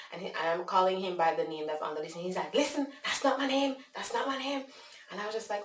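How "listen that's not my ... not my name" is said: high-pitched exasperated protest; upset. Retold or imitated. Man correcting a caller about his name, imitated exasperation. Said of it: "And so he's upset".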